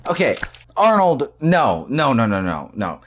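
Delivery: ordinary talk, nothing marked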